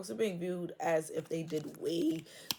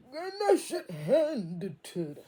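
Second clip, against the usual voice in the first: emphatic warning; 6 semitones higher, 5 dB louder, slower than usual. Real, not acted. Emphatic prediction, voice cracking.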